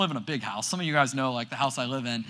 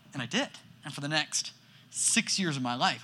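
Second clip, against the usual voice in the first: ordinary talk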